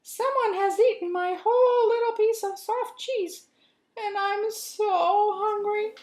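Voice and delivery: tiny mouse complaining he is hungry; squeaky baby mouse. Acted. Baby Mouse mourns his eaten cheese, very high squeak.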